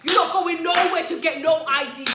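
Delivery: acting out a loud, indignant man; loud, insistent refusal. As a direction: shout it down, slow and emphatic. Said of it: "he was so loud".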